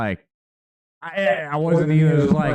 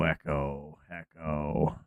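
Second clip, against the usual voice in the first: fading echo; 9 semitones lower, 10 dB quieter, slower than usual. Shown as a demonstration. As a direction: echo fading away into quiet. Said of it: "Am I echoing?".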